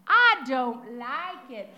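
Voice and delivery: loud, slow and stubborn; a granddaughter stubbornly refusing her grandmother's hairdo, loud and slow; child's flat refusal. Retold or imitated. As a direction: plant your feet and refuse it, slowly and loudly. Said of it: "she was quick to tell me".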